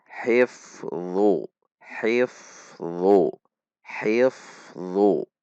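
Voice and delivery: "Hifdhu" with streaming f and thick dh; tense the tongue root on the end of "Hifdhu"; correct heavy dha. Shown as a demonstration. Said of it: "Anda bisa melatihnya".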